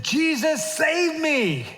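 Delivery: high, pleading cry; pleading Peter. Acted. Peter calling to Jesus from the water, pleading. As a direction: beg to be rescued. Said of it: "He said".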